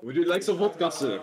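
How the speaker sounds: high, loud hammed-up Russian accent; Russian vodka offer. Stalin offers the French leader vodka, in a hammed-up Russian accent. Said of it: "it's me, Stalin".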